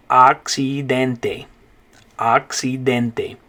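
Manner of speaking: accidente drawn out, ahk-see-DEN-teh; slow Spanish model word. Shown as a demonstration. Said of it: "say the vowel sounds first, then go back and say the word".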